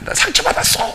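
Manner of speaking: high, breathy mock whimper of someone hurt; mocking hurt whine. Preacher mocking a hurt churchgoer, high thin mock whine. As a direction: parody a person crying out that they're hurt. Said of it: "막 이래".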